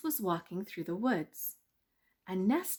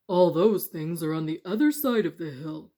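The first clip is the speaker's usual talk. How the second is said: stone voices; 2 semitones lower, 10 dB louder than usual. Acted.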